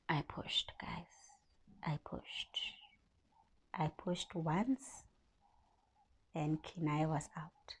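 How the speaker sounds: whispered and slow, reliving the birth; barely voiced, hushed with emotion. Real, not acted.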